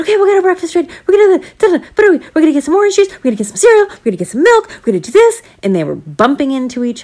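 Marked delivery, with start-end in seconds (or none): 0.0-5.4 put-on high, loud, giddy kid voices; eager children rushing breakfast; excited kids. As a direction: bounce through it like hyper seven-year-olds.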